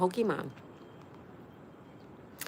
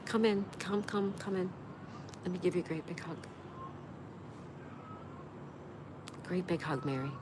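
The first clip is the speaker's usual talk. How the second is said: comforting hug; 2 semitones higher, 6 dB quieter than usual. Real, not acted. Offering a comforting virtual hug.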